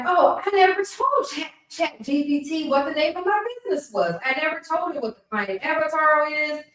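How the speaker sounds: retold sudden realization. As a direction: act out suddenly catching your own mistake. Acting out her sudden realization.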